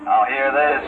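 over the loudspeaker; tinny ship intercom call; PA announcement. Acted. PA announcement repeated.

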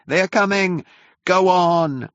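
high, urgent warning cry; panicked crowd shout. A narrator's reading. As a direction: shout to hurry the crowd along.

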